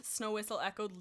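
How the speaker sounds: normal talking voice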